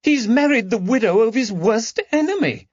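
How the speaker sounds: the lady's voice rising in distress. A narrator's reading. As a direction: let the outrage climb. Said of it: "Lady Caroline's voice rose with distress".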